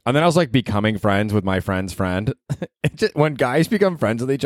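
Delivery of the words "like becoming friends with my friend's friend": ordinary delivery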